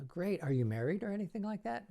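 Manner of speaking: high, fast teasing showman voice; a silly magician teasing a child volunteer, high and quick; silly host. Shown as a demonstration. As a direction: ask the kid a ridiculous question.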